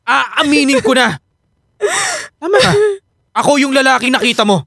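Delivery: giving in, voice cracking; breaking confession. Acted. Husband admitting he cheated, close to tears.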